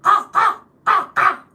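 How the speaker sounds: shrill it out like a real crow; real crow caw. Shown as a demonstration. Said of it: "Can you do the real sound?".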